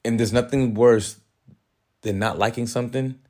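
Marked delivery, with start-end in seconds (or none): none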